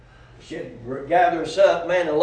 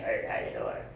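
much lower, rough smoker's hello; raspy old smoker voice; 9 dB quieter than usual. Retold or imitated. Preacher mimics an old smoker greeting him on the job, low and rough. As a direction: growl it like a woman with ruined vocal cords. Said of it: "He talked like that".

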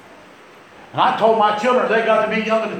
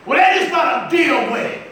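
a higher, louder snapped retort; angry retold yell; 9 semitones higher, 4 dB louder than usual. Husband snapping at his wife in the car, mock yell. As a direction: bark it back at her.

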